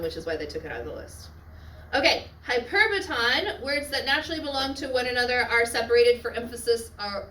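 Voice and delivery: normal talking voice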